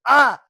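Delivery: sudden high, loud yell; abrupt cut-off scream. Acted. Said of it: "about to scream".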